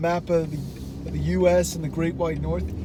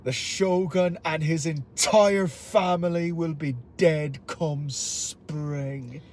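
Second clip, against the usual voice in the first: Irish-accented threat slowing to the end; Abijah Fowler; slower than usual. Shown as a demonstration. Villain threatening before Japanese nobles, slow menacing speech. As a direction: deliver the threat, drawing out the last words. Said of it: "these are Fowler's words".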